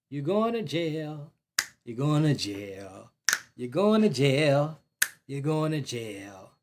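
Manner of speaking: slow, drawn-out repeated taunt, dropping low. Real, not acted. Commenter telling the crying killer he's jail-bound, taunting. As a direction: chant it slowly, rubbing it in.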